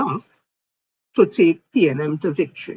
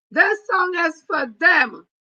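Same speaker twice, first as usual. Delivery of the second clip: high, slow, loud dedication; defiant shout; 8 semitones higher, 4 dB louder, slower than usual. Real, not acted. Dedicating the song to her haters, slow defiant shout. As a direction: fling it at the haters.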